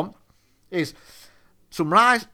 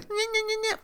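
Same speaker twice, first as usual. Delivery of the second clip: high-pitched mocking "nyeh" babble; mocking nyeh noises; 5 dB quieter than usual. Shopper knocking about the aisles, imitated in high mocking gibberish. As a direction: imitate dithering shoppers with squeaky nonsense noises. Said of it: "You know, they're like".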